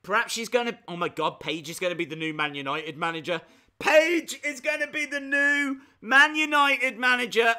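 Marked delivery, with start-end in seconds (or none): 3.8-7.5 loud, high, thrilled announcement; excited shout. Real, not acted. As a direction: shout it out with glee. Repeats idea, thrilled.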